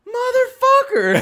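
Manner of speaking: high, loud, drawn-out curse; exasperated yell. Retold or imitated. Hearing the drill he'd mocked on the new album, a loud disbelieving curse. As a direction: let it burst out in disbelief. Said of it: "I'm sitting there going".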